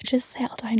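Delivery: hushed, calming whisper; soothing whisper. Retold or imitated. Telling angry people to calm down, in a soft whisper.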